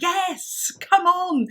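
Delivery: high, emphatic egging-on; eager urging. Retold or imitated. Friend pushing a shy mum toward the front, eager urging. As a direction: urge a hesitant friend forward, pitched right up.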